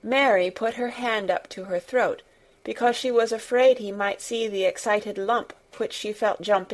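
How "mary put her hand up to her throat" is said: ordinary delivery, nothing marked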